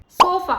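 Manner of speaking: सोफा ending in a short flat 'uh'; flat A sound. Shown as a demonstration. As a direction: say सोफा with a weak final vowel. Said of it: "ए की आवाज बिल्कुल flat आ आती है". (सोफा के अंत में हल्का सा 'अ')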